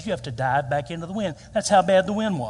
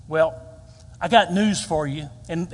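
ordinary talk

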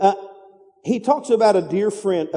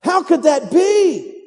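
mock disbelief; 7 semitones higher, slower than usual. Mock disbelief at late sleepers.

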